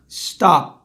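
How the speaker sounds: "stop" ending on a silent closed p; no air released. Shown as a demonstration. Teacher demonstrating no release, "stop" cut at the p. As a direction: hold the air back after the p in "stop". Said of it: "não solte mais ar".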